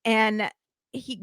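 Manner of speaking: normal talking voice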